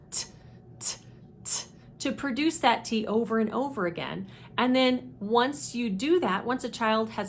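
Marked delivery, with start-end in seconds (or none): 0.1-1.6 "t" tapped like a ticking clock; clock sound. Shown as a demonstration. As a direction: repeat "t" as short clicks. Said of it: "the cue of the clock sound".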